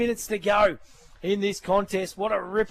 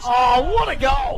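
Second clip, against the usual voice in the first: excited; 3 semitones higher, 5 dB louder, slower than usual. Real, not acted. Co-caller reacts to goal.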